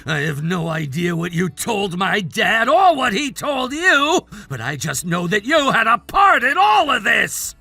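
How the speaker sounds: desperate, angry outburst. Acted. Man blaming rival for his downfall, desperate shouting. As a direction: lash out in desperation, stretching the blame. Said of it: "You're so desperate now".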